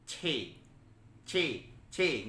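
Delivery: "C" pushed out with pressure, as chi; stressed C. Shown as a demonstration. Teacher demonstrating pinyin Q, stressed ch sound. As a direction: press hard on the "C", a sharp ch sound. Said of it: "dengan tekanan gitu ya".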